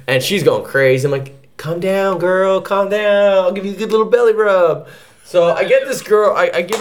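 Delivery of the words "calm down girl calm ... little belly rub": mildly soothing dog talk, a bit fast; calm an excited dog with light baby talk. Retold or imitated.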